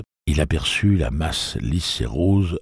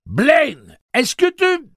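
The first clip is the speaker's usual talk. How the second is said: much higher, loud call that breaks off; shout; 9 dB louder, much slower than usual. Acted. Susanna calls out to Blaine, cut off: loud high shout. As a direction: yell his name and get cut off. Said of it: "cria Susanna".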